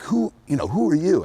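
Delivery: high-pitched imitation of affronted rivals; mock indignant challenge. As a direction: demand it like a put-out rival. Imitating challenged, outlifted bodybuilders.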